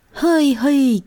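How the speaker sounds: high-pitched cheering call; children's whoop. A narrator's reading. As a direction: cheer out like a band of village kids. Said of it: "chiuiră copiii".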